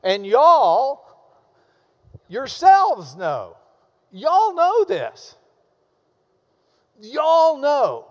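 lightly Texan, loud, slow and drawn out; Texan rendering. Shown as a demonstration. Preacher translating Paul's verse for the congregation, Texan rendering. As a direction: lean on each y'all in mild Texan, high and loud. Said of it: "translate this into Texan".

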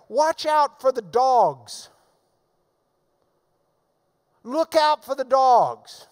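solemn pulpit emphasis; preaching at full force. Acted. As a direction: bear down on the warning. Preacher repeats the warning.

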